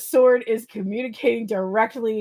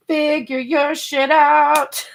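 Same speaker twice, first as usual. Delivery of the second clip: higher, slow, laughing it out again; laughing repeat; 6 semitones higher, 4 dB louder, slower than usual. Real, not acted. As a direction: crack up while repeating the line.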